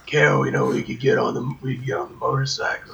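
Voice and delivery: absurdly deep Batman-style voice; Daryl Dixon. Acted. Survivor talking to Carol about leaving, mock deep gruff voice. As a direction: push it low, struggling to whisper. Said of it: "that's what he sounds like".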